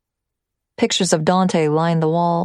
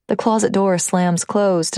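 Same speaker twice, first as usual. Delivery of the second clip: ordinary delivery, nothing marked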